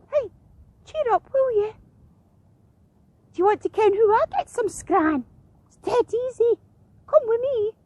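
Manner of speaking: high-pitched, friendly gull voice; coaxing seagull. A narrator's reading. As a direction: cheer him up in a squeaky gull voice. Seagull coaxes the sad heron.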